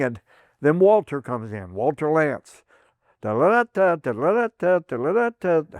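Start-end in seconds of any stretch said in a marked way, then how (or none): 3.2-5.8 high sung-out cartoon woodpecker laugh; woodpecker call imitation. Veteran imitating the Woody Woodpecker cartoon call, high and sing-song. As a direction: rattle it off like the cartoon bird.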